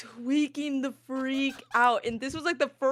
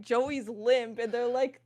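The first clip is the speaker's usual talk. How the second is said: normal talking voice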